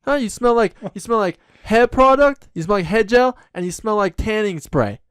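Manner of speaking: high, loud put-on Bronx tough guy; Bronx accent. Podcast host mocking Jersey for next week's episode, in a loud Bronx accent. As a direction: trash Jersey like a Bronx native. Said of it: "be from the Bronx".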